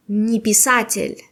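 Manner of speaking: «не писатель» run together as one word. Shown as a demonstration. Teacher shows students the negation, «не писатель» blended as one word. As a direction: say «не писатель» with no break, unstressed не. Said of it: "как одно слово".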